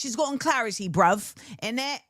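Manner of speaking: hammed-up British slang delivery; British accent. Joking about the rapper's clarity, mock British accent. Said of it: "talk about it in a British accent".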